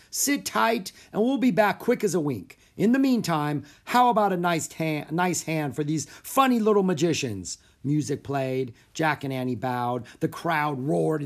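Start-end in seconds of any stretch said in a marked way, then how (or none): 0.1-7.6 high, raised showman's call; shouted. A narrator's reading. Houdini asking the audience for applause, projecting. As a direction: project cheerily to the whole house. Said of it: "Houdini shouted to the audience".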